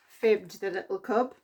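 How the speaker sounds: usual speaking voice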